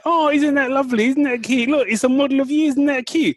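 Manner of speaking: high, gushing mock admiration; gushing fans. Friends gushing over a birthday gift, high and cooing. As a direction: coo over it like a sweet gift. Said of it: "I had loads of people going".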